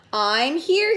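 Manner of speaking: ordinary delivery, nothing marked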